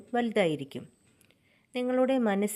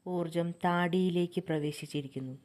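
calm, softer meditation voice with long pauses; guided meditation; 2 semitones lower, 3 dB quieter than usual. Acted. Meditation guide leading listeners through breathing and rising energy, calm and measured. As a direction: guide them gently through each breath and body part, unhurried.

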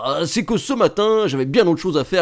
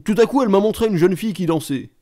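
ordinary talk, nothing marked

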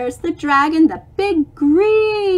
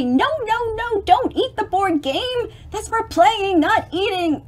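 put-on girl scolding; 8 semitones higher than usual. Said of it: "the little girl pulling".

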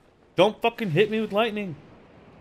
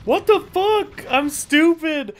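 frustrated shout; 8 semitones higher, 6 dB louder, slower than usual. Real, not acted. Dies in game.

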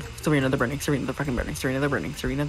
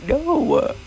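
high denial; 11 semitones higher, 6 dB louder than usual. Real, not acted. Denying moving the plate.

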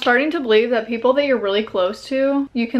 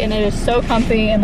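ordinary talk

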